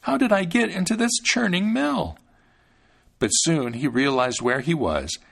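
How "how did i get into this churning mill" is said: put-on high, small voice of a thumb-sized boy; little Thumbling, fretful; Thumbling's tiny voice. A narrator's reading. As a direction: pitch it up like a tiny hero.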